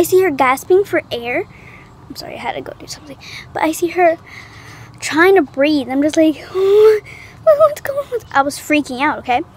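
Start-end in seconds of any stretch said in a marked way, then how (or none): 2.1-3.2 fast whispered apology. Real, not acted. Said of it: "my little brother just shushed me". 6.4-8.2 gasp, then high scared question; panicked gasp. Retold or imitated. Panic on seeing friend.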